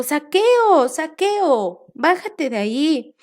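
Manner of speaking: loud high call up into the tree; shouting to him. Acted. Jesus shouting up at Zaqueo in the tree, loud and high. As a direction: call his name loudly up the tree. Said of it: "le gritó y le dijo".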